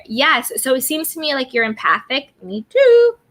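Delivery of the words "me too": high, loud "Me too"; excited. Real, not acted. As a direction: eagerly agree.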